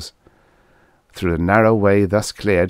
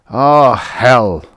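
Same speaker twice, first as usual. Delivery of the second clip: loud, disgusted grunt; contemptuous grunt; 5 semitones higher, 9 dB louder than usual. A narrator's reading. Guthrie dismisses talk of rescue ships, contemptuous. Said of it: "grunted Guthrie contemptuously".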